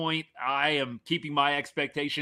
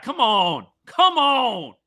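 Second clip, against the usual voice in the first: much louder and higher, exasperated; frustrated yell; 9 semitones higher, 10 dB louder, much slower than usual. Real, not acted. Host yelling at his team's defense, frustrated shout.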